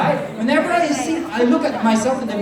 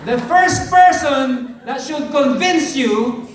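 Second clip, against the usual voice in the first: loud, high rallying preacher voice; a big motivational shout from the stage; preaching at full force; 5 semitones higher, 4 dB louder than usual. Acted. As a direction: fire up the crowd like a revival preacher. Motivational speaker rallies the audience.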